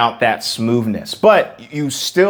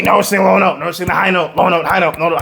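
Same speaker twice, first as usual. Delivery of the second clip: bickering registers; 5 semitones higher, faster than usual. Acted.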